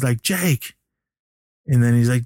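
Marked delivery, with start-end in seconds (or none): 0.2-0.7 high, falling call without extra volume; retold calling out. Billy calling after Jake, mock high call. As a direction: imitate calling a departing friend back.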